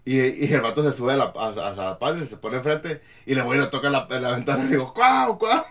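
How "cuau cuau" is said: calling out. Retold or imitated. Calling to player through window.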